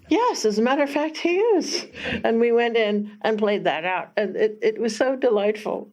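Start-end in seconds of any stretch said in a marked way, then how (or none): 0.1-1.9 playful. Retold or imitated. Playing along with the child.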